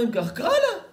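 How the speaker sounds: high, loud mock demand; challenging demand. Friend challenges the liar to prove it, demanding loudly. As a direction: throw down the challenge, pitch jumping up.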